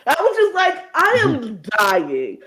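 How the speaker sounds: loud, high, slow delighted cry; laughing gush. Retold or imitated. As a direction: wail it, overcome with glee. Recalling delighted reaction.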